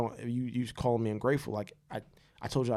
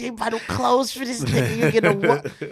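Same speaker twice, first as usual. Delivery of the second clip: much higher, slow, laughing mock woman's whine; hammed-up mimicry of a complaining mother; mocking nagging woman; 12 semitones higher, 11 dB louder, much slower than usual. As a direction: lampoon her list of complaints, squealing through laughs.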